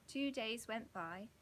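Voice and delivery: ordinary delivery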